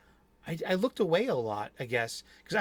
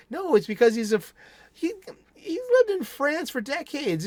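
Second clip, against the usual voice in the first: excited correction; 10 semitones higher, 4 dB louder than usual. Real, not acted. Correcting himself about Depp.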